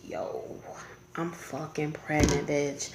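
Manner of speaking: low, slow and very soft; subdued, dragging admission; hushed confession. Retold or imitated. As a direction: break the news under your breath. Retold confession to boyfriend.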